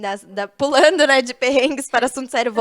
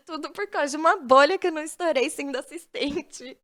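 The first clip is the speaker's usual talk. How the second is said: normal talking voice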